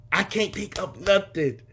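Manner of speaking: hammed-up, drawn-out despair; mock panic. Son blanking on a memory of dad, mock panic.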